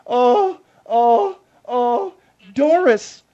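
drawn-out, put-on swoon over a star; mock swooning. [name] mocking a swooning [name] fan, hammed-up. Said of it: "not sitting at home going".

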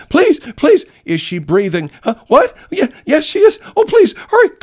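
very high, loud mock panic; frantic fake 911 plea, high and loud; faked panicky caller. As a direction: ham up a guilty husband's hysteria. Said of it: "the operator's saying".

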